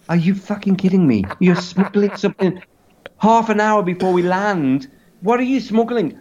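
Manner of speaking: high, appalled mock protest; incredulous outrage. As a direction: act out horrified disbelief, pitched high. Acted outrage at smuggling confession.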